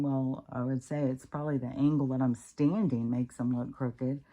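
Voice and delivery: ordinary delivery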